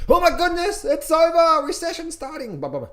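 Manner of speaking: high, loud panicked wail; mock panic. Mocking fearful traders in a high panicked voice. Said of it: "everyone was jumping out of the buildings".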